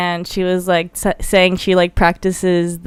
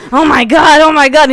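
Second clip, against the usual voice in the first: louder and higher, frustrated; exasperated road rage; 7 semitones higher, 6 dB louder than usual. Retold or imitated. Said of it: "you're just like".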